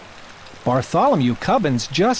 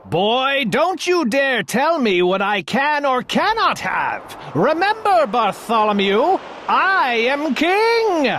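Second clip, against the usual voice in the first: scolding king; 8 semitones higher than usual. A narrator's reading. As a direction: lay down the law like an outraged king.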